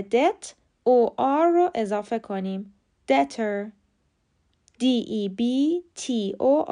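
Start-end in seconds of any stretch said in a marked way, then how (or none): 3.1-3.7 debtor said "DET-er"; silent B. Shown as a demonstration. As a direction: leave the B out of debtor. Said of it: "بی سایلنته و خونده نمیشه".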